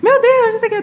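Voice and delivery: imitated high, loud cry of disbelief; overjoyed dad. Daughter imitating dad hearing her score, overjoyed. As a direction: shriek it like an astonished, thrilled father. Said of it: "Todo emocionado".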